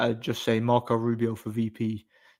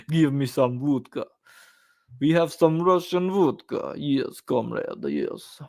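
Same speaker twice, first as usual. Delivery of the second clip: mock Russian accent, slower and higher in places; playful fake Russian accent, half laughing; 4 semitones higher, slower than usual. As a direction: ham up a cartoon Russian soldier asking for vodka. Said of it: "the Russian accent is pretty cool".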